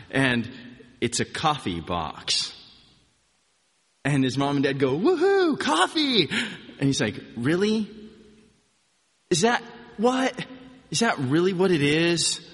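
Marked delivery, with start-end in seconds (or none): 5.0-6.3 high, loud mock cheer; hammed-up parental cheer; excited cheer. Parents cheer over coffee gift. 7.4-12.4 disappointed. Retold or imitated. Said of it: "tone of disappointment in his voice".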